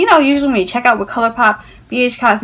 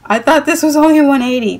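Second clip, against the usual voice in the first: higher-pitched protest of disbelief; mock-shocked at the bill; retold dismay; 5 semitones higher, 3 dB louder than usual. Acted shock at the total.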